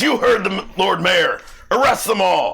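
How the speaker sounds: loud, high-pitched angry order; bad-mood constable. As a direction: shout it like an irate constable giving orders. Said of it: "he looks in a particularly bad mood".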